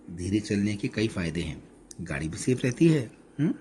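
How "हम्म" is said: ordinary delivery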